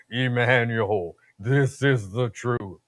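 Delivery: slow, deep, shaky divine voice; God voice. Acted. God revealing truth to the speaker, deep shaky voice. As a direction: intone it slowly with a deep quaver. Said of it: "that's how God talks".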